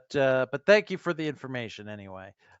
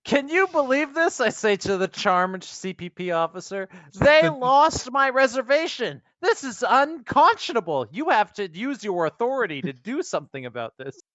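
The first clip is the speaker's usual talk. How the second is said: much higher, louder outrage; celebrity tantrum; 8 semitones higher, 5 dB louder than usual. Acted. Fake tennis star complains to the officer in high, loud outrage. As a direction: shriek it like a spoiled star.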